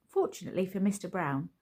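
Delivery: ordinary delivery, nothing marked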